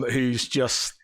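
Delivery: normal talking voice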